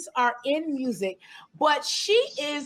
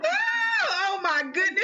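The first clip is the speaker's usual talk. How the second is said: overjoyed cry; 5 semitones higher, slower than usual. Real, not acted. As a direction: squeal it out, thrilled. Host overwhelmed greeting guest.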